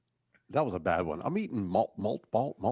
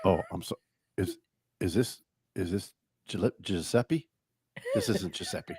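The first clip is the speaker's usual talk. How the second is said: flustered apology; 5 semitones lower, 10 dB quieter than usual. Pretend. Imagining a caller's awkward retreat, soft and halting. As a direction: back off sheepishly on the phone. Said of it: "beg your pardon and go".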